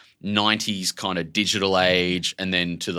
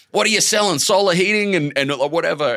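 mock suspicious passer-by; 11 semitones higher, 7 dB louder than usual.

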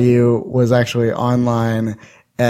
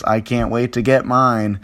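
normal talking voice

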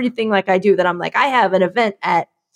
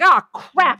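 higher, sudden alarm; mock panic; 6 semitones higher than usual. She mimics remembering an event, high and alarmed. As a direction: realize you're late. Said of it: "I'm like".

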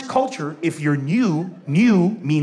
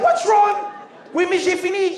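high, raised-voice bewildered protest; exasperated bafflement; 12 semitones higher than usual. Acted. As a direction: cry it out, utterly at a loss. Baffled pupil protests again.

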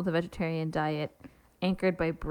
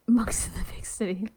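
whispered through a laugh. Real, not acted. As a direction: breathe the title as a joke. Whispered joke title.

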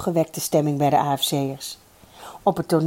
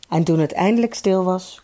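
ordinary delivery, nothing marked

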